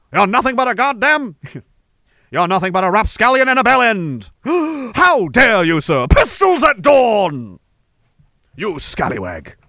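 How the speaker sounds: a mock-gallant challenge, pistols at dawn shouted; old-fashioned gentleman. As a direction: play a proper old-time gentleman taking offence. Old-timey duel insults acted out.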